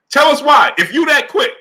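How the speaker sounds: host challenging his chat to explain their pick, loud and laughing; fired-up demand. Real, not acted. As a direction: call out the chat, half laughing in disbelief.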